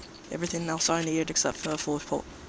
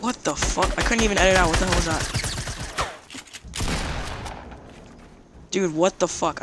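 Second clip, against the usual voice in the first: frustrated; 2 semitones higher, 3 dB louder than usual. Real, not acted. Raging at lag.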